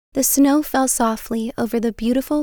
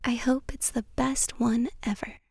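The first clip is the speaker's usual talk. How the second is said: hushed unvoiced whisper; 8 dB quieter than usual. A narrator's reading. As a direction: breathe it out softly. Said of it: "he whispered".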